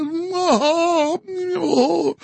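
high villain laugh. Retold or imitated. Mock villain laugh in impression.

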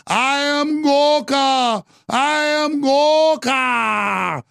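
slow, high shouted monster voice; booming mock Gorka. Host mocks Gorka as a slow, shouted monster. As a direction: bellow it like a movie monster. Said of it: "mocking Gorka".

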